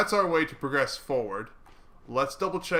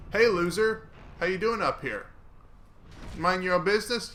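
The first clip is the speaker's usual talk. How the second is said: mocking taunt; 6 semitones higher than usual. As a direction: jeer at the enemy. Taunting enemy archer mockingly.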